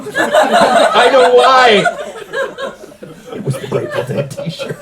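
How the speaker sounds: slow, high, breathy laugh-talk; wheezing through the joke. Real, not acted. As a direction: barely get it out for laughing. Co-host laughing.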